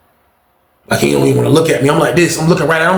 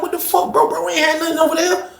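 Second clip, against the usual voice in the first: much higher, incredulous outcry; high-pitched disbelief; 4 dB quieter than usual. Retold or imitated. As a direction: insist it makes no sense. Arguing friend was clean.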